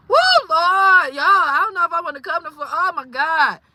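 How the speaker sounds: high, loud cry to the Lord; overwhelmed dismay. Real, not acted. Rattled at the thought of visiting Florida, overwhelmed. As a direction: exclaim it, astounded and rattled.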